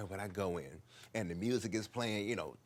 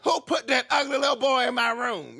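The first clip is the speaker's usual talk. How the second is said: high loud shout from down the hall; shouting drag queen; 14 semitones higher, 11 dB louder than usual. Retold or imitated. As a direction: holler it down the hallway, high and loud. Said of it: "I can hear her down the hall".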